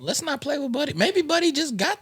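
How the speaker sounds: mock high voice. Mock voice for Buddy.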